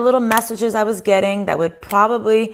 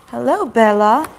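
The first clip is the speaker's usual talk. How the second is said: talking to the dog; 2 semitones higher, slower than usual. Real, not acted. Greeting the dog.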